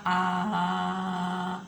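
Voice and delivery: one long, sustained "A" on a steady pitch; A prolongado. Shown as a demonstration. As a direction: hold the "A" as long as you can, as for the doctor. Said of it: "com o maior tempo que vocês conseguirem". ("A" bem esticado, numa nota só)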